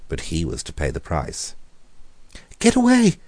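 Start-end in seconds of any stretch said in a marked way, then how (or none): 2.6-3.2 high, loud terrified scream. A narrator's reading. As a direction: scream it in panic. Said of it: "he screamed".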